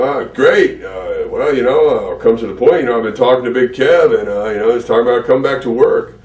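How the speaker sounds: loud, high imitation of the boss getting to the point; Vince McMahon impression. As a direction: pitch the deal like a pushy tycoon. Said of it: "Who does such a good Vince impersonation".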